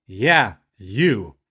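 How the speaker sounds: loud, emphatic and confrontational; single someone out firmly; retold challenge, punched loud; pointed call-out. Confronting the diner, singling him out.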